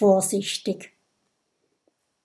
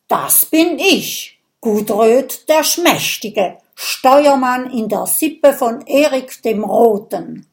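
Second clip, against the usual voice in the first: proud proclamation; 3 semitones higher, 6 dB louder than usual. Acted. Viking proudly introduces himself to the friends, grand. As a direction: rise and declare your name grandly. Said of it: "erhebt sich ... und verkündet".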